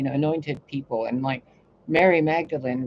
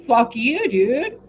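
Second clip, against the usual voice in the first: louder, higher fuck you at God; defiant outburst, pitched up; mock defiant retort; 7 semitones higher, 6 dB louder, much slower than usual. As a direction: snap back at God defiantly. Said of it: "And I'm like".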